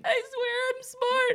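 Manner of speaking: very high, slow, whiny self-defence; hammed-up squeaky pleading; mock wailing plea. Embarrassed self-defence acted out.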